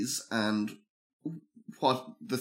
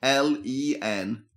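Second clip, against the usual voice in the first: slow letter-by-letter spelling; slower than usual. Shown as a demonstration. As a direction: spell each letter out clearly, slowly. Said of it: "spell that for me there".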